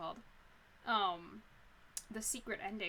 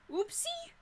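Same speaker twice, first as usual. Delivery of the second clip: incredulous squeak; 8 dB louder than usual. Real, not acted. Incredulous at the excuse.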